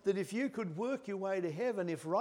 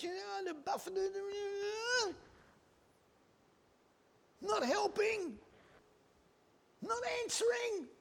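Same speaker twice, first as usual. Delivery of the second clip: much higher, pleading cry rising to a shout; Paul's anguish; 13 semitones higher than usual. Acted. Paul pleading with God over his thorn, anguished. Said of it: "Can you hear it in the Apostle Paul".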